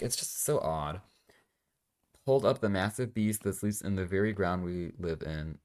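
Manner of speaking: ordinary talk, nothing marked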